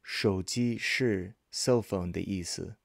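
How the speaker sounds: slow, spaced-out teaching sentence; drawn-out delivery for learners to follow; slow repeat. Shown as a demonstration. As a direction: model it slowly, phrase by phrase. Model answer repeated slowly for learners.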